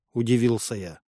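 usual speaking voice

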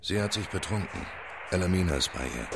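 quiet report. Acted. Low private report on a woman.

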